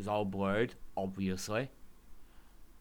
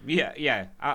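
usual speaking voice

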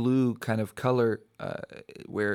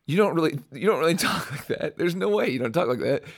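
retold incredulous protest, pitched up; laughing mock disbelief, higher voice; amused disbelief; 4 semitones higher than usual. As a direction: call their bluff with a light laugh. Retold teasing disbelief at locals' accent.

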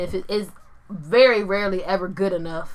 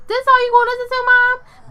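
much higher, louder mock kid voice; squeaky kid complaint, hammed up; imitating her kid; 6 dB louder than usual. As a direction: play the teenager teasing mom. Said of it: "Gio be like".

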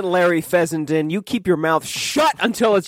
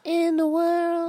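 high mock voice; 14 semitones higher, 4 dB quieter, slower than usual. Echoing in mock voice.

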